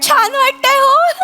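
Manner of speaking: slow, quavering, moved; overdone teary gratitude; tearful delight. Acted. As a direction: say it through happy tears. Widow moved sitting at counter.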